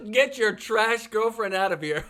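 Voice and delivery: laughing at the insult; giggling while repeating the line. Real, not acted. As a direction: quote it through laughter.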